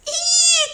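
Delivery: very high loud squeal; children's high thrilled squeal; delighted squeal. A narrator's reading. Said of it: "cried the children".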